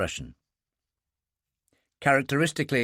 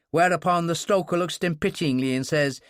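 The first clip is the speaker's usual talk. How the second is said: usual speaking voice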